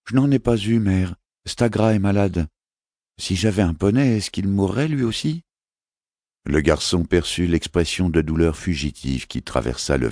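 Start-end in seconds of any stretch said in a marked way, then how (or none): 0.1-5.4 put-on higher, earnest little boy; a small prince asking his mother; child's voice, lifted and innocent; young boy voice. Boy Alexandre speaks to mother.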